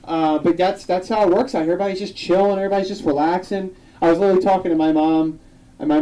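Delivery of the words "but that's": ordinary talk, nothing marked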